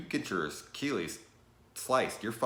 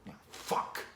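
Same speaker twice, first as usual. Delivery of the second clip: annoyed whisper; 4 dB quieter than usual. Retold or imitated. Chucky annoyed at the kid, whispered curse. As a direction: whisper it, fed up. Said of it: "he's just like".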